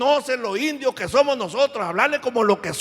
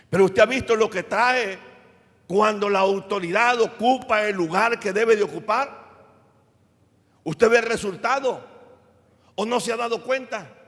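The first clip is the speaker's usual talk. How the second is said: building to a louder climax; preaching; 3 dB louder than usual. Acted. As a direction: rise to a louder close on paz. Predica hasta el clímax. (predicación que crece hasta un final más fuerte)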